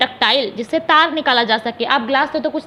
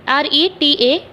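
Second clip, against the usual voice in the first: letters spelled one by one, slowly; spelling out letters; slower than usual. Shown as a demonstration. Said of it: "ठीक है, retaliate". (धीरे धीरे अक्षर बोलकर spelling)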